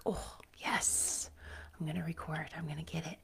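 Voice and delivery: hushed excited whisper. Retold or imitated. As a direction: whisper it to yourself. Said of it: "and I was like".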